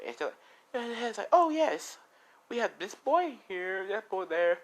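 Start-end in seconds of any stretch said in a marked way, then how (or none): 1.3-4.6 high, slow, sing-song helper voice; a chirpy caretaker pointing out children; cheery orphanage worker. Retold or imitated. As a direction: show off the kids in a high, slow lilt. Orphanage worker showing the boys.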